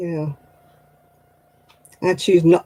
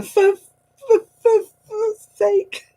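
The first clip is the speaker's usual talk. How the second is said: high, slow stammered for sake; stifled swear; much slower than usual. Retold or imitated. She hates swearing, strangled high stifled curse. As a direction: stutter the curse out in pain. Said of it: "I'm going".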